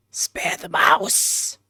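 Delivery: slow, voiceless plea; whisper. Pretend. Player still choosing to save the mouse, drawn-out whisper. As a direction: whisper it slowly and insistently.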